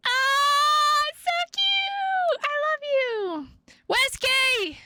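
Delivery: overjoyed high squeals; squealing delight. Acted. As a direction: gush over a beloved cat. Greeting beloved lost cat.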